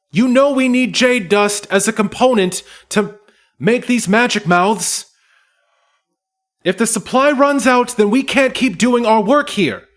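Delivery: very high, loud furious gnome; shrill-free high gnome woman ranting at Jorn; angry gnome yelling. Acted. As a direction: screech at the dwarf in outrage. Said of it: "Moonbeam looks up and says".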